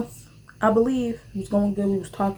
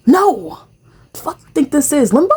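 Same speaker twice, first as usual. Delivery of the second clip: indignant; 6 semitones higher, 7 dB louder than usual. Real, not acted. Indignant rant.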